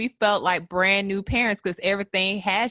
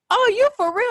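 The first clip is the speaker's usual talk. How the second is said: surprised sister; 6 dB louder than usual. Retold or imitated. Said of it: "she was like".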